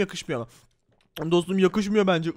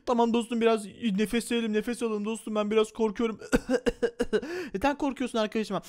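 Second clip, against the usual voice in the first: usual speaking voice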